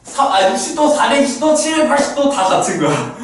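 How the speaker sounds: teacher suddenly getting carried away; sudden excitement. Real, not acted. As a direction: blurt it out all fired up. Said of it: "또 급발진해".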